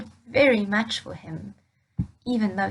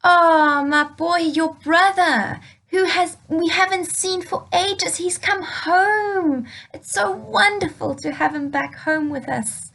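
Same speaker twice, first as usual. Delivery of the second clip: louder, glowing father breaking the news; joyful father; 6 semitones higher, 6 dB louder than usual. Acted. Father telling older son his brother is home, joyful and louder. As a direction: burst out with the good news.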